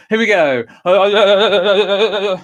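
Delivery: loud, high "uh" jolted by a body shake; shaking voice. Shown as a demonstration. Choir leader shaking, voice jolted by body. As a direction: let the shake rattle each "uh". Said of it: "shaking on the internet".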